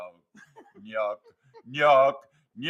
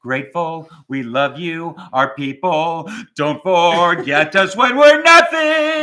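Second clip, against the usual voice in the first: slow, louder, hammed-up farewell plea; slow mock-dramatic send-off; mock grand farewell; 5 semitones lower, 5 dB louder than usual. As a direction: deliver it like a fading star's goodbye. Mock farewell speech to fans.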